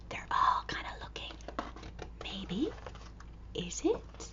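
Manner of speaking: hushed, whispered suspense; storyteller hushing the kids before the reveal. Acted.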